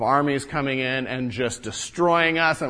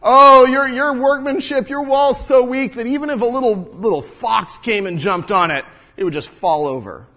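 higher, loud mocking sidekick jeer; sneering jibe; 7 semitones higher, 6 dB louder than usual. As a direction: belittle their work with a sneer. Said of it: "he chimes in at the end".

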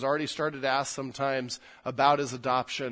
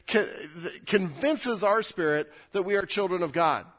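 normal talking voice

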